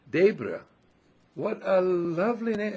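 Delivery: high, loud gushing compliment; heartfelt admiration. Retold or imitated. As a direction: imitate someone delighted by a lovely name. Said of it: "And she meant it".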